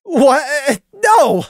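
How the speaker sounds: high, loud startled screech. Acted. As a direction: yelp it out in embarrassed shock. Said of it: "Randy screeched".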